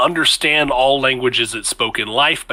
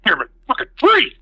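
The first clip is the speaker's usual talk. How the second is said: chuttering under breath. Acted. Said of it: "There's chuttering under his breath".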